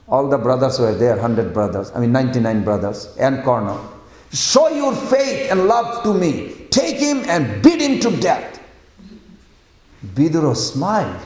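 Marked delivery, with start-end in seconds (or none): 4.3-8.6 high, commanding outburst; furious royal command, pitched up; angry. Acted. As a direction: play the enraged king giving orders. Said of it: "so angry with Vidura".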